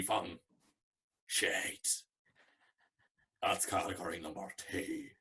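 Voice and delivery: put-on slow drunk Belfast voice, shouted good, whispered shite; imitating a drunk Belfast man's rating system; a drunk Belfast co-judge ranking competitors, Belfast accent. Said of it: "This is what he sounded like at this hour".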